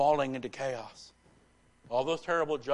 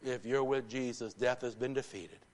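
ordinary talk

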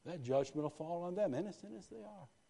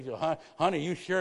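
loud, higher pleading wife; worried wife; 4 semitones higher, 10 dB louder than usual. Retold or imitated. Wife questioning her husband about moving to Brooksville, loud and doubtful.

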